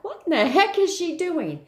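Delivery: high, puzzled dog voice; voicing the dog. Sitter voicing the dog's puzzled look, high and bemused. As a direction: play the confused dog. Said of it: "he looked at me like".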